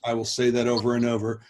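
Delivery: ordinary delivery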